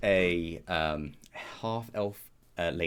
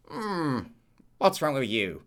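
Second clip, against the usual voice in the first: higher, louder gnome voice; grumpy gnome; 7 semitones higher, 3 dB louder, slower than usual. Acted. Gnome Fred greets the panicking wizard, grumpy character voice. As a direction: challenge them as a gruff old gnome. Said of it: "he looks at you and goes".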